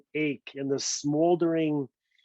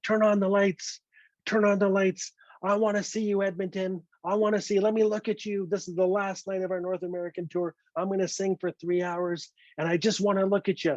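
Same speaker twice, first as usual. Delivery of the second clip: higher-pitched frontman calling out to his crowd; 5 semitones higher than usual. Retold or imitated. Rock singer addresses Edmonton crowd mid-concert, calling out. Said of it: "[name] was in fine form".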